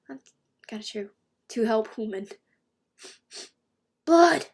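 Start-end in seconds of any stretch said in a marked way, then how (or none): 0.1-1.1 whispering. Real, not acted. Quiet aside after reading. 4.0-4.5 loud high alarmed cry; shouting. Acted. Reading comic panel aloud.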